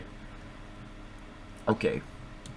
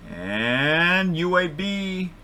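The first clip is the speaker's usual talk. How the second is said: high, slow, stretched-out reveal; drawn-out suspenseful announcement; 10 semitones higher, much slower than usual. Real, not acted. Host reveals the wheel's pick, drawn out in suspense. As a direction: draw it out as the pointer settles.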